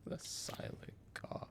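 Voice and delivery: "The Silent Caw" in a breathy hush, no voice; whisper. Shown as a demonstration. Streamer hushing the item name, whispered. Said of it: "The Silent Caw said plainly".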